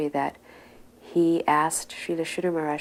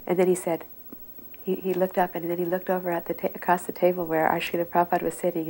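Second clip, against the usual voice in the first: ordinary talk, nothing marked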